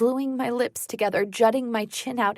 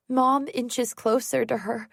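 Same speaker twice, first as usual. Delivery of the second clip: usual speaking voice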